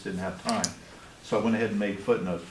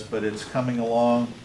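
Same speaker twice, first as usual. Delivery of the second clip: ordinary talk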